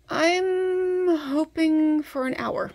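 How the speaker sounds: high, loud and drawn out; excited. Real, not acted. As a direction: sing out the hope slowly. Wishful exclamation, high and loud.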